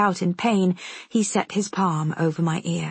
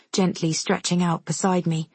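plain reading voice

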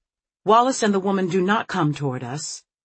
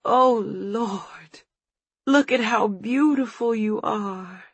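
higher, tender and tearful; slow, high-pitched tearful wonder; tearful awe; 5 semitones higher, much slower than usual. A narrator's reading. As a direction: marvel through welling tears. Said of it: "her eyes filling with tears".

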